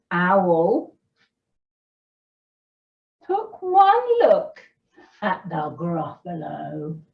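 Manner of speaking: slow, animated storyteller; story reading to children. A narrator's reading. Storyteller reading the Gruffalo to children, animated and unhurried. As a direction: read it slowly with big pitch swoops and pauses.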